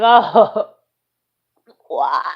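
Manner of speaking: a mock "Oh" ending in a laugh; laughing mock reaction. Reacting to the Facebook post, laughing disbelief. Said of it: "And I was like".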